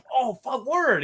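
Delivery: high, pumped-up imitation of a friend; excited. Ice getting the finished video, excitedly high. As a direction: mimic his excitement, pitched well up. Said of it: "he was all excited".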